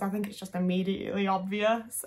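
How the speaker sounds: normal talking voice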